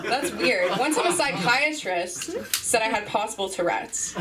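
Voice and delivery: ordinary delivery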